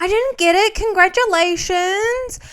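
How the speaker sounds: high, loud retold cheer; playfully overdone delight; mock congratulations. Acts out Julia hearing the news.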